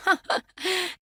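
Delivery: light, high-pitched laugh; amused little laugh; high giggle. Acted. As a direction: giggle briefly. Amused reaction in acted dialogue.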